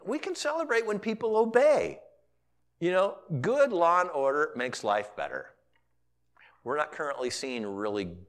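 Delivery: usual speaking voice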